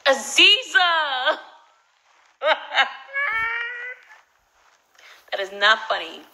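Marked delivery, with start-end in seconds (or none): 0.1-1.4 high, loud, drawn-out name call; stretched-out scolding cry; real exclaimed protest. Real, not acted. 2.4-4.1 high, loud squealing laugh; helpless high-pitched cackle; real laughing. Real, not acted. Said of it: "I am laughing".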